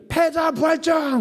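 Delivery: slow, drawn-out game-show shout of "패자 부활전"; mock announcer shout. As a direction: belt it like a TV host unveiling a twist. Announcer proclaims surprise round. (방송 진행자처럼 느리게 외치기)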